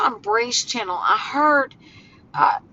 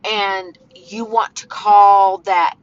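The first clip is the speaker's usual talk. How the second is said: usual speaking voice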